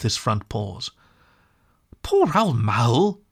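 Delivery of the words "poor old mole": slow, hushed and kind; kindly. A narrator's reading. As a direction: whisper it gently to comfort a hurt friend. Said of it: "said the rat kindly".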